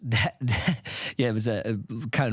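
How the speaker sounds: laughing through the words; trying not to laugh about egg prices; stifled laugh. Real, not acted. Host cracks up over egg inflation, stifled laugh. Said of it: "I'm trying not to la".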